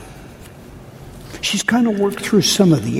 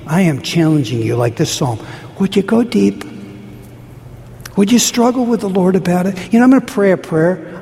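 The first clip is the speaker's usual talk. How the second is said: normal talking voice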